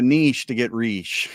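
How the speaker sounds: "niche" as "neesh", "riche" as "reesh"; joke rhyming pronunciation. Pretend. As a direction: make "riche" rhyme playfully with "niche". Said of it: "new ways to pronounce words".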